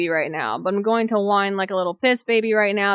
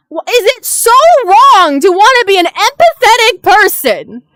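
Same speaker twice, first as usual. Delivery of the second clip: exasperated pleading; 7 semitones higher, 6 dB louder than usual. Real, not acted. Venting despair at the world.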